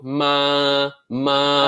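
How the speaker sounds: ma held high and level; ma sung on a steady high pitch; first tone. Shown as a demonstration. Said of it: "that's just your high, high note".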